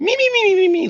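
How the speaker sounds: high-pitched squeaky meeping; Beaker. Retold or imitated. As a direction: mimic gibberish in a tiny squeal. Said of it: "You sound like Beaker".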